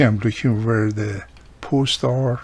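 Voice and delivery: ordinary talk, nothing marked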